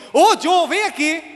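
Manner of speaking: high, loud-starting summons of a cross mother; angry mother. Retold or imitated. Mother with raiva calling her son, angry shout. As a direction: call him over sharply, pitch jumped up. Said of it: "quando minha mãe tava com raiva".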